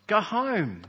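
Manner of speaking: usual speaking voice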